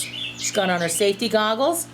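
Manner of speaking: normal talking voice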